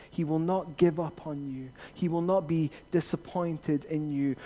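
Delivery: normal talking voice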